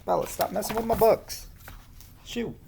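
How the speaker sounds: high, exasperated shoo; shooing the pet. Real, not acted. Reader shooing her pet away from the books, exasperated. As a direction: chase the cat off the books.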